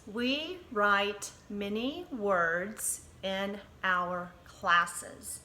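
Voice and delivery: very slow, word by word; slow dictation. Shown as a demonstration. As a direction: read it slowly for dictation. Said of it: "say that together".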